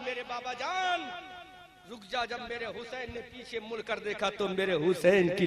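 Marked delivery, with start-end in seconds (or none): 0.1-2.2 much softer, barely-there call; faint weak plea. Acted. Sick daughter calls her father back, faint plea. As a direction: say میرے بابا جان رک جا almost under the breath. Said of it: "آواز آئی".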